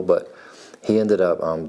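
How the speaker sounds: normal talking voice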